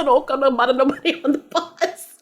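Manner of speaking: quoting her through laughter; laughing quote. Real, not acted. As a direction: giggle the line out.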